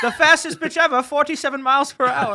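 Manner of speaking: put-on high, loud old-time baseball play-by-play; old radio announcer. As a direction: call the pitch like a 1930s radio man. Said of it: "as an announcer voice".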